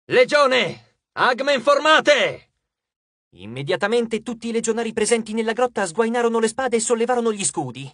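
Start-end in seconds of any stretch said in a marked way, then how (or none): 0.1-2.5 loud, high, drawn-out military order; shouted command. A narrator's reading. Frank rallying the legion in battle, shouted command. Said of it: "quando gridò".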